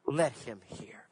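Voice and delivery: hushed repeat. Real, not acted. Preacher closing to the congregation, softly. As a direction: Let him hear, dropped low and soft. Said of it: "scripture repeated, fading".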